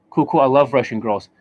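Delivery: ordinary talk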